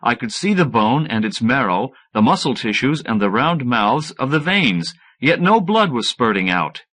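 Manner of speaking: ordinary delivery, nothing marked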